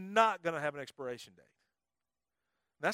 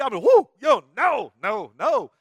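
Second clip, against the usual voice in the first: mock panic; 3 semitones higher, 15 dB louder, slower than usual. Pastor reacts to the heebie-jeebies.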